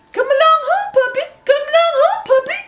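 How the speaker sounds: high, loud coaxing call; mammy shows how to lead a puppy on a string. Shown as a demonstration. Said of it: "just like this".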